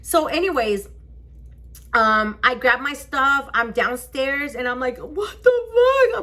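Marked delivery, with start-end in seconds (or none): 5.0-6.2 high, slow, loud wail; drawn-out imitation sobbing outburst; retold crying. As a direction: wail it in mock tears. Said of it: "I'm crying".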